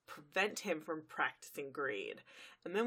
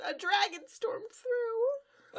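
much higher, slow, tearful; frightened whimper; 3 dB louder, much slower than usual. Retold or imitated. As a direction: quaver it out, scared stiff. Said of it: "She goes".

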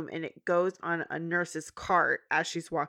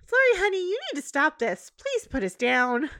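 higher, louder coaxing plea; pleading Twilight; 9 semitones higher, 4 dB louder than usual. Retold or imitated. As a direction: coax the baby, pitched high.

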